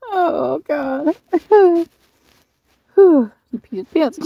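high, wheezing laugh-talk; gasping through giggles. Real, not acted. Laughing at a funny photo.

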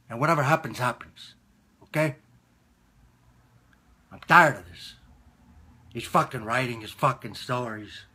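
ordinary delivery

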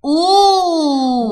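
"أوه" drawn out high as "oooo"; long oo vowel. Shown as a demonstration. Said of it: "تاني صوته بيبقى عامل ازاي". ("أوه" ممدودة بالواو)

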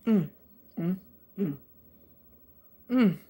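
chewing while humming; eating a burger; mouth full. Real, not acted. Seen 0: burger bitten at mouth, then lips closed chewing while humming.

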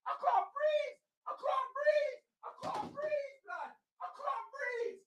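high, faint, gasping disbelief; breathless despair. Real, not acted. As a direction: squeak it out as if the air has gone. Fan overwhelmed by conceded goals.

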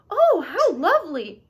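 high, loud chorus of pleasure; storybook gushing; delighted. A narrator's reading. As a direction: swoop up and down in delight. Everyone exclaims over juice.

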